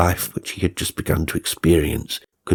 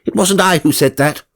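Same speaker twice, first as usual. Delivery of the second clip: high, loud protesting shout; exasperated shout; 11 semitones higher, 4 dB louder than usual. Acted. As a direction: yell it so she finally hears. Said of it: "he shouted".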